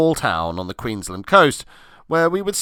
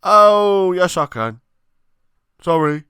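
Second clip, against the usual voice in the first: relieved exclamation; 8 semitones higher, 7 dB louder than usual. Acted. Chris relieved under noodles.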